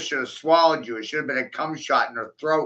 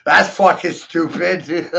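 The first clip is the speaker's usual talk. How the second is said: laughing through the words, slowed; laughing scorn; 2 semitones higher, 6 dB louder, slower than usual. Real, not acted. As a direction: scoff while still laughing. Laughing at own joke.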